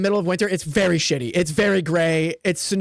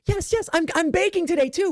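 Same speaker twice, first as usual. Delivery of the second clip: chirpy NPC; 9 semitones higher than usual. Acted.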